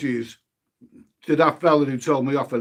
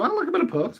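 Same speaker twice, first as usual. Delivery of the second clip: much higher, squeaky put-on voice; 14 semitones higher, faster than usual. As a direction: squeak the line up high. Repeats line in high voice.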